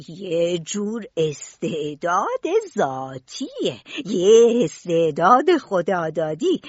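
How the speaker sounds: expressive teaching, key words stretched out; old gardener, patient marvelling. Acted. Gardener teaching his pupil about seeds, patient marvelling.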